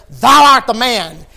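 slow, loud, high pronouncement; loud solemn accusation; prophet's accusation. Acted. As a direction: point and declare it slowly. Nathan accusing David.

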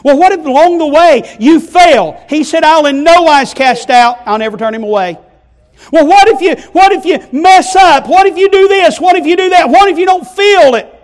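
loud, high shouted questions; preaching at full force. Acted. Preacher to congregation, shouting what-ifs. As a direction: fire the doubts at the crowd.

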